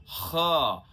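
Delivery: ख with a rasping throat sound; nukta kh. Shown as a demonstration. Teacher demonstrating ख with nukta, throaty kh. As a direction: scrape ख at the back of the throat like a Urdu kh. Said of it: "ख में लगने वाले नुक्ते के बारे में".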